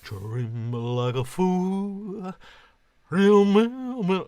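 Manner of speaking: slow, drawn-out crooner voice, then a loud high burst. Shown as a demonstration. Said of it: "That's not Elvis".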